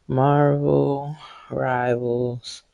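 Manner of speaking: drawn out slowly and softly while typing; slow dictation. Real, not acted. Typing the stream title, slow soft dictation. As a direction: spell it out slowly as you type. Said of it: "typing the stream title aloud".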